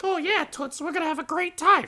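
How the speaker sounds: very high cartoonish dummy voice; squeaky lecherous puppet, fast at the end; the dummy flirts with the showgirl, high and cheeky. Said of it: "the dummy is just like".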